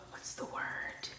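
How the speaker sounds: breathy under-the-breath question; whispered. Real, not acted. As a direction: whisper it to yourself. Repeats the question to herself.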